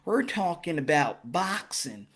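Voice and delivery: mellow it right down like a TV preacher; calm soft-spoken sermon delivery on boxing; Joel Osteen voice. Acted. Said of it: "I gotta be like Joel Osteen and talk like this".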